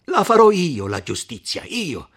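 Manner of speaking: loud, high defiant shout; furious vow. Acted. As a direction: shout it as a sworn threat. Renzo shouts his vow.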